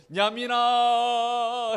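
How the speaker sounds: high, loud, long-drawn call to little brother; acted wailing. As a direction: draw the name out in a mock cry. (동생을 부둥켜안고 길게 울부짖는 시늉)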